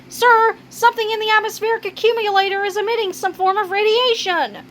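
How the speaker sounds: high, quick underling voice; reporting underling. Acted. Underling reports danger to boss.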